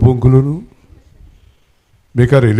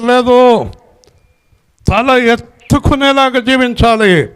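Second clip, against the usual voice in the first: much higher preaching cry, swelling at the climax; full-force preaching; 11 semitones higher than usual. Acted. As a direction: lift the whole hall with a high call. (ఉచ్ఛస్వరంలో ఉత్సాహ ప్రసంగం)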